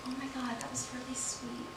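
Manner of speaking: very soft, imitating sympathetic guests; hushed sympathy. Guests consoling the maid of honor, mock hushed sympathy. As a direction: murmur it gently, far under your voice.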